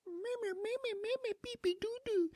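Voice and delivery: put-on squeaky baby-talk gibberish; very high nonsense babble; Jar Jar Binks. Fan mocking the character's baby talk, squeaky babble. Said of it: "his baby talk is just".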